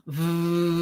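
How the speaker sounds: long voo breathed out through the lips; slow voo with teeth on the lips; voo exhale. Shown as a demonstration. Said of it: "Exhale with the voo sound".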